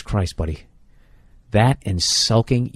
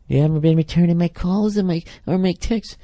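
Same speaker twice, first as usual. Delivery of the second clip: much higher, put-on girlfriend voice; whiny girlfriend voice; 10 semitones higher than usual. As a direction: play the girl complaining on the phone. Acted phone call with the girl.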